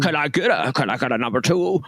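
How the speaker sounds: lightly put-on character voice; a hint of a sitcom oddball ordering; Jim from Taxi. Customer ordering at McDonald's, lightly put-on character voice. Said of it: "he's ordering at McDonald's".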